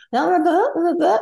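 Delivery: high rising blah blah; mock Valley Girl drone. Shown as a demonstration. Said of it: "It's Valley Girl".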